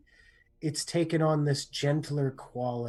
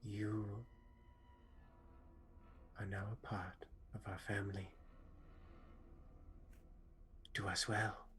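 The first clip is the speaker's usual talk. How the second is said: hushed blessing; 5 semitones lower, 19 dB quieter than usual. Acted. Triel blesses the new family member.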